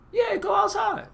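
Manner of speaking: very high-pitched sing-out permission; mock-breezy parent. Parent sending punished kid outside, high and breezy. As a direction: send them off in an exaggerated high voice.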